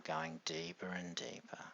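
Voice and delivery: whispering. Acted. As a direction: breathe the words out as a whisper. Whispered deepening suggestion.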